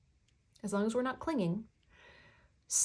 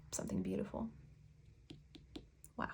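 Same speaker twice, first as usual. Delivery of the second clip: whispered; 13 semitones lower, 14 dB quieter, faster than usual. Real, not acted. Hushed reverent aside.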